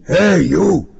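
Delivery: high, forceful shout of a traffic cop; husky shouted command; loud husky cry. A narrator's reading. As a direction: bellow it as an urgent order. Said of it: "the voice cried".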